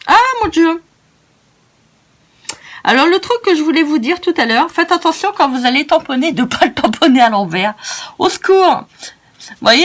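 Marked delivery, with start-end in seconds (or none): 0.1-0.8 high, loud exclamation of dismay; dismayed cry. Real, not acted. Dismay at her mistake. 8.2-8.8 mock cry for help. Mock plea after stamping wrong.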